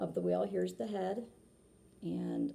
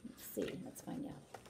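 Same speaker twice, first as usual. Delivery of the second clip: whisper; 8 dB quieter than usual. Real, not acted. Teasing suspense for the kids.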